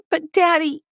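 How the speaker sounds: put-on very high, fearful child voice; high-pitched, loud pleading girl; scared little daughter. A narrator's reading. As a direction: protest to Daddy in fear. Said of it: "her eyes teary, her face full of fear".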